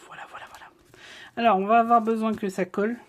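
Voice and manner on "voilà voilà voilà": very soft whisper to oneself. Real, not acted. Crafter talking to herself while lining up pieces, whispered. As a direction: whisper it under your breath.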